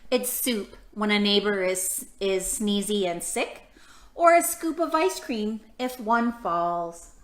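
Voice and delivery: lilting read-aloud for little listeners; reading to children. Acted. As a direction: read each picture-book line gently to the kids. Reading picture book to kids.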